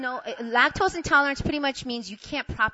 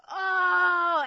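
loud, high groan of pain; mock agonized "oh"; 10 semitones higher, 6 dB louder than usual. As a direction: groan out a stomach cramp. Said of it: "It's just me groaning".

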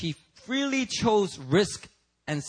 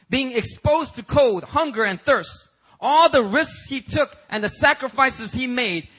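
fervent preaching; 8 semitones higher, 6 dB louder than usual. Acted. Preacher listing Paul's sufferings to the church, loud and fervent. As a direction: pile up each hardship louder.

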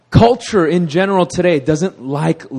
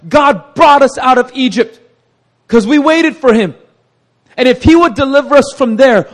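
preaching build; 10 semitones higher, 8 dB louder than usual. Real, not acted. Preacher rising to full force.

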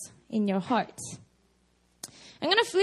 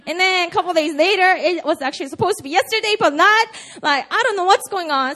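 louder, fast, rising exasperation; exasperated rant; 3 semitones higher, 6 dB louder, faster than usual. Real, not acted. A pastor ranting about ever-changing missile news, fast and exasperated. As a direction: pile it on faster and louder until it bursts.

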